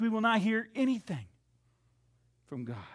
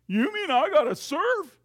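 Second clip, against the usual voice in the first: high, louder incredulous objection; reluctant protest; 8 semitones higher, 9 dB louder than usual. Acted. Preacher voicing a reluctant believer, incredulous and louder. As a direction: sound put out at being asked. Objecting listener voiced mid-sermon.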